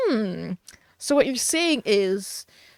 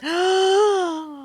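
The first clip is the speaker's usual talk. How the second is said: shocked gasp; 8 semitones higher than usual. Real, not acted. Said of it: "Let someone with actual talent stream".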